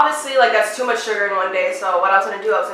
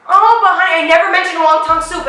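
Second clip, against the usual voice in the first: higher, louder mock objection; indignant protest; 6 semitones higher, 5 dB louder than usual. Mom defends herself to daughter, indignant protest. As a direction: object with a raised voice.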